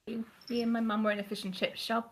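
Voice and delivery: ordinary talk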